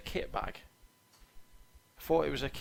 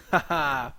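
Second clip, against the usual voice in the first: loud hearty laugh; 10 dB louder than usual. Real, not acted. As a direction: burst out laughing loudly.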